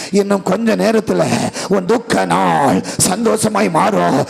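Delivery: ordinary delivery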